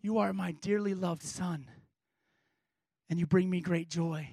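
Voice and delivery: low, hushed, tender voice; Father's voice from heaven. Acted. God speaking to his Son at baptism, hushed and tender. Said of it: "And then a voice".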